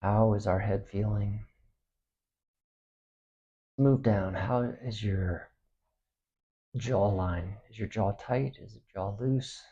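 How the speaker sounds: soft, unhurried meditation voice with long pauses; hushed, spacious relaxation guidance; guided body scan meditation. Acted. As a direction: guide them gently through the body, quietly.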